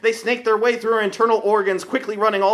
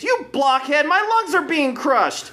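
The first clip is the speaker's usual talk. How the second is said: put-on shrieking girl; 7 semitones higher, slower than usual. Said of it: "Lucy shrieked".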